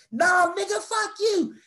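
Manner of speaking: imitated high-pitched screech; hammed-up angry yell. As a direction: act out her charging back furious. Girls charge back in, acted.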